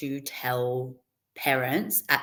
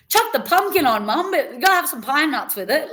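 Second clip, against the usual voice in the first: much higher and louder, bossy and eager; eager excited; 10 semitones higher, 5 dB louder than usual. Retold or imitated. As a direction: demand it like a thrilled kid. Eager kid ordering mum's cooking.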